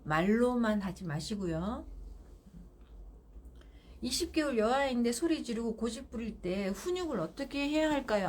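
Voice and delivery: ordinary talk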